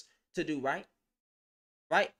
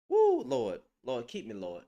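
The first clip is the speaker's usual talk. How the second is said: stifled laughter; 6 semitones lower, 4 dB louder than usual. Real, not acted. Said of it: "I'm trying my hardest not to".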